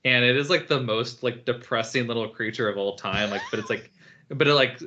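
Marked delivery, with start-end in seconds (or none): none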